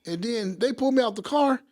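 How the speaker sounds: ordinary delivery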